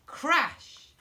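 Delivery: loud, punched crash. A narrator's reading. Narrator telling the door crashing, loud crash. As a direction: bang out the word crash.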